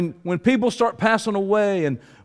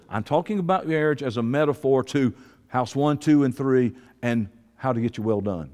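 ordinary talk, nothing marked

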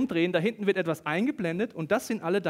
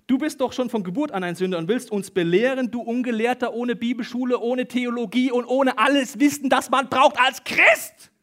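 loud, raised-pitch angry tirade; furious shouting; 6 semitones higher, 8 dB louder than usual. Retold or imitated. Enraged accusers berating the healed man, furious shouting. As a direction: build to a shout, drawing out the final words. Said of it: "Wütend schrien sie ihn an".

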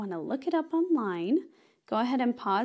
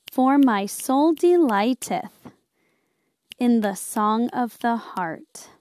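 slow recitation; 6 dB louder, slower than usual. Shown as a demonstration. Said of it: "Listen to me the first time".

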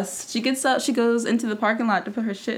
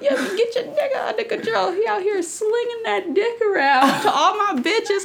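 high mock rant; 11 semitones higher than usual. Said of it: "she's like".

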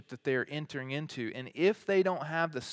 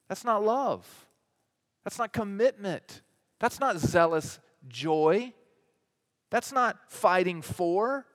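higher, louder and slower on each not; emphatic preaching; 5 semitones higher, 4 dB louder, slower than usual. Acted. Preacher refuting an indifferent God, emphatic and slow. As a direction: hammer every not home. Preacher hammering repeated denials.

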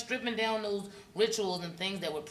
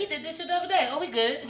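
high, lilting naive brush-off; mock naive girlfriend; 8 semitones higher, 5 dB louder than usual. Woman excusing boyfriend's repeat behavior, high naive tone. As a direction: shrug off the red flag sweetly. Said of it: "imitating woman excusing red flag".

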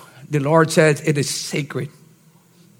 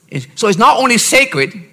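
usual speaking voice

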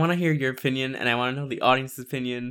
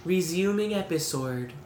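higher, posh British "Resuming episode" with rounded vowels; imitating a British voice assistant; 5 semitones higher, 3 dB quieter than usual. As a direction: mimic the app's woman voice saying "Resuming episode". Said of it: "the way she says it is like".

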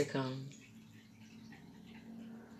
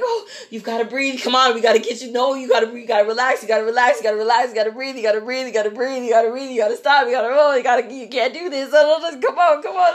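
raised, breathless-run repetition building higher; frantic pleading; 6 semitones higher, 4 dB louder, faster than usual. Retold or imitated. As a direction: rattle it off in mounting mock panic. Acts out frantic blood draw.

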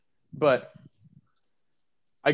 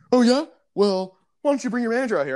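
mock indignant customer; 9 semitones higher, 4 dB louder, faster than usual. Customer demands manager.

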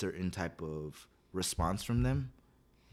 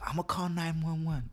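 put-on high, slow childish threat; whiny kid threat; 9 semitones higher, 3 dB louder than usual. Kid to the adults, threatening to call 911, high whine. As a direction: pitch way up and drag it like a kid bluffing.

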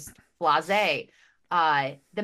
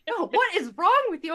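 much higher, louder mock-incredulous husband; hammed-up baffled "what is wrong"; exasperated husband; 10 semitones higher, 5 dB louder than usual. Husband baffled at his sobbing wife, incredulous.

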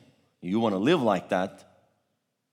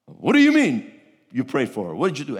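angry demand; 4 semitones higher, 6 dB louder than usual. Retold or imitated. Angry father confronting preacher.

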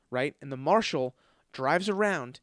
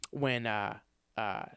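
ordinary delivery

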